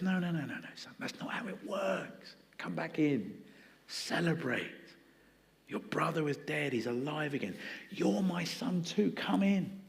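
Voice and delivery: soft, hushed, gentle pleading; tender father. Retold or imitated. Father quietly coaxing his sulking older son, tender. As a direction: coax the sulking son back inside softly. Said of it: "But the father says".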